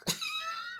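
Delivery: squealing burst of laughter; high shrieking laugh. Real, not acted. Vlogger shrieking with laughter at the man.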